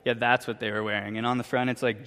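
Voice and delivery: usual speaking voice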